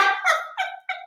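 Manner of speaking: high squealing laugh. Real, not acted. Host squealing with laughter at the clip. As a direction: laugh it out helplessly.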